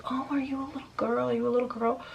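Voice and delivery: lightly acted creepy sing-song questioning; imitated creepy neighbor. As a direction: mimic a perverted man in broken English. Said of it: "very weird and perverted".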